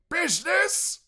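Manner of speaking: high, loud outraged cry; crying ghost. Acted. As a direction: fling the word back in anguish. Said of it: "cried the ghost".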